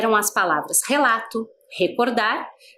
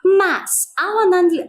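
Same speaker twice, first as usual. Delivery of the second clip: ordinary talk, nothing marked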